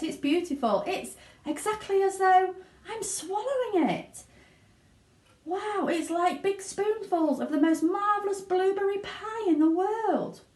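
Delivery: high, breathless delight; thrilled. Acted. Violet raves about the taste, thrilled. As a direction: gush over the blueberry pie. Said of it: "shouted Violet".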